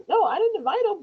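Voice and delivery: high-pitched mock denial; retold protest. Answering son's father about the invite, high retold protest. Denies inviting him, acted argument.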